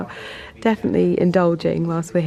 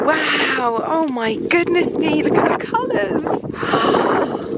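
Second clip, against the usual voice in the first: awestruck; 8 semitones higher, slower than usual. Real, not acted. Awed at autumn colours.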